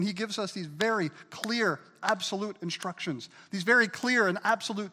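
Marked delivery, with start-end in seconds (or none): none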